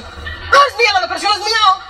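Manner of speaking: high-pitched, hammed-up childish protest; mock possessive wail. Sufferer guarding their depression, comic wail. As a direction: squeal like a hammed-up jealous owner.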